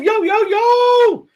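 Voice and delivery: hype announcer. Acted. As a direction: announce him like a big star. Hyping up a chat supporter.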